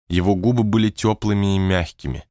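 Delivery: ordinary delivery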